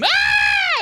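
very high, loud scream; girls' scream. Retold or imitated. Girls screaming at the blind guide, high shriek. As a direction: shriek in fright. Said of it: "they all scream".

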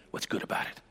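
very soft, barely voiced retort; deflating mutter. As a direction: drop right down to a hushed, sour aside. Contrast with his loud chapel quote.

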